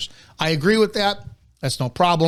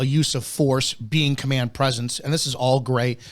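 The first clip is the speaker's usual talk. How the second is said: ordinary delivery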